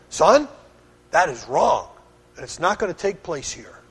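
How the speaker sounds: firm. Retold or imitated. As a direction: correct your son sternly but calmly. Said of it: "I said it firmly like that".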